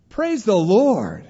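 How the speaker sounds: slow, high, loud exultation; joyful praise. Acted. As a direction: proclaim it with delight. Joyful reply to the enemy.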